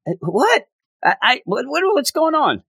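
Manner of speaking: high, confused stammering what-what-what; baffled surprise. Retold or imitated. Reviewer mimicking his own confusion, high and baffled. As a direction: sputter like the comic lost you completely. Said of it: "I'm like".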